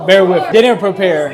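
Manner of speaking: a few shots in, laughing through it. Real, not acted. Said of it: "I'm six shots deep".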